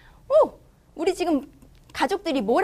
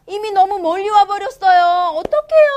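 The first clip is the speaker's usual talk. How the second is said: mock distress; 5 semitones higher, 5 dB louder than usual. Dramatic worry over lost dog.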